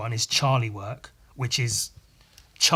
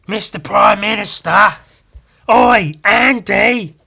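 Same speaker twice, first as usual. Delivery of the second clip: loud, high hammed-up Australian accent; 8 semitones higher, 11 dB louder, slower than usual. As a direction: shout it in broad Australian accent. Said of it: "That's my Australian accent".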